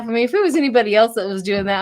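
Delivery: ordinary talk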